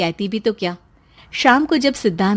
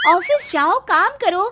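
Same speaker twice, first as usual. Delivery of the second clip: much higher bossy scolding; scolding wife; 8 semitones higher than usual. Acted. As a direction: shoo him off to work. (डाँटती पत्नी)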